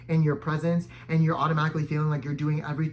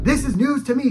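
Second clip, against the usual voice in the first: higher-pitched retold incredulity; mock disbelief; 8 semitones higher, 4 dB louder than usual. Driver reacting to a blinker accusation, incredulous. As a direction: lift the pitch in feigned surprise.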